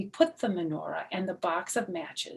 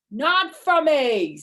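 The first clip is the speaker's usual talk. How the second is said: sulky goblin; 8 semitones higher, 10 dB louder, slower than usual. Acted. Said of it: "the goblin said".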